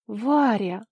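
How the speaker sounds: loud, amazed exclamation; gasped name. A narrator's reading. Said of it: "ахнула женщина". (изумлённо ахнув)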